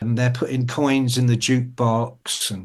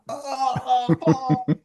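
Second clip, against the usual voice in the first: high, drawn-out "oh" recoiling from light; mock dazzled groan; 10 semitones higher, 4 dB quieter, much slower than usual. Reveller meets morning sunshine, mock pained groaning. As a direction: groan as if sunlight hits you. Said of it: "when they opened that door and the sunshine".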